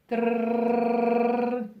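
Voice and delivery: rolled r drumroll. Acted. Mock drumroll before reveal.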